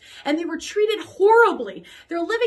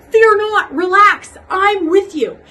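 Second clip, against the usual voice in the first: commanding reassurance; 5 semitones higher, 8 dB louder, slower than usual. Acted. God instructs Moses.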